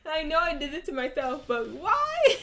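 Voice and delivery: high, laughing complaint; laughing protest. Real, not acted. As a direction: laugh through the outrage. Teased by chat, laughing in protest.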